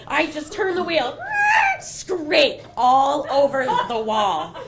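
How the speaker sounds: loud, rushed panic in the telling; panicked yelling storyteller; excited loud retelling. Retold or imitated. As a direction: relive the near crash, shouting. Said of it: "I start screaming".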